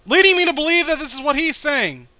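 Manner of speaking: high, loud ranting at a badly drawn page; vent, pitched high and loud, at the comic; exasperated rant. Real, not acted.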